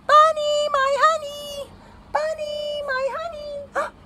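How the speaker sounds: high singsong call, fading from loud to distant; mommy calling. A narrator's reading. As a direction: call far and wide for your lost little one. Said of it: "He hears his mommy calling".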